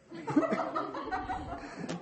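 high breathy laugh. Real, not acted. Laughing at the story.

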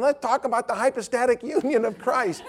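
high mock-academic voice; mock lofty scholar; pompous theology student. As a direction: send up a know-it-all. Mocking a pretentious young man.

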